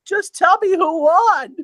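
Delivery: put-on high, slow whiny teen voice; teenage kid on the phone. Kid calling the bookie for scores, high and drawn out. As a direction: play a pushy fifteen-year-old demanding answers. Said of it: "fifteen, sixteen-year-old was".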